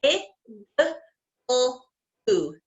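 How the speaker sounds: "I, g, l, oo" said as separate sounds; sounded out letter by letter. Shown as a demonstration. Teacher sounding out igloo for young students, one sound at a time. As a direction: break igloo into its sounds, pausing between each. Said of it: "do igloo".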